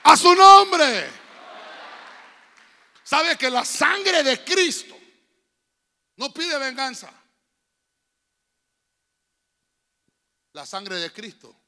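shouted, slow, building sermon climax; preaching at full force. Acted. Pastor rousing congregation to clap for the King, shouting. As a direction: preach it loud to the rafters.

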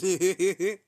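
short high cackle; imitated cackle. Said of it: "she started laughing exactly like that too".

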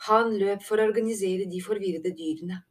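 ordinary delivery, nothing marked

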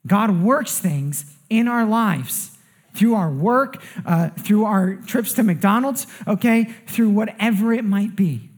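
slow, weighty sermon delivery; preaching. Acted. Pastor driving the lesson home to his congregation, slow and weighty. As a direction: press the point home to the church, slowly.

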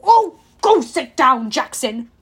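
weary, impatient order; exasperated sigh. A narrator's reading. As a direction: wave off a tiresome kid. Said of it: "Dionysus sighed".

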